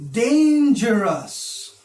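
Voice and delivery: DANGEROUS said slowly and loud, DAN stretched; drawn-out example word. Shown as a demonstration. Teacher giving an example word to count, slow and emphatic. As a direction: stretch "dangerous" out, leaning on the first syllable. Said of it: "when I say".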